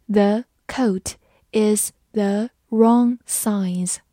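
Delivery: slow, one word at a time; slow read-along. Shown as a demonstration. Teacher leads students through the sentence, slow read-along. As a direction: pause after every word for learners to follow. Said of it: "慢慢来读".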